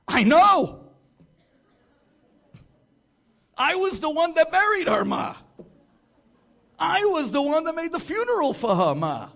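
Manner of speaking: loud, high exasperated cry; exasperated son yelling into the phone; upset shout. Retold or imitated. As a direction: blurt it out at full volume, frustrated. Son upset over funeral mix-up.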